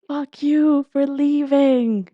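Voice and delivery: imitating a stranger's raw yell at the temple; retold anguished shout. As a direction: cry it out at someone who left. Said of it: "they just shout out from the top of their lungs".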